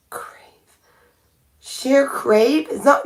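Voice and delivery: ordinary delivery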